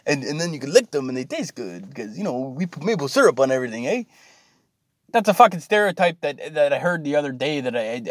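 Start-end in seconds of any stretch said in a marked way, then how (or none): 0.1-4.0 hammed-up Canadian lilt. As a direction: play the cliché Canadian, eh. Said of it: "very stereotypical, you know, Canadian accent".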